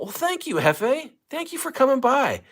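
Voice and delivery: high, sing-song mock gratitude; sarcastic thanks. Real, not acted. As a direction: brush off the insult sweetly. Answers insult "show is awful.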